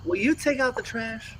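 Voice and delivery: mock-sweet wife asking a favour; husband mimics his wife's sweet request; imitating his wife. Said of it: "Wives all around the world, my wife included, tells me things like".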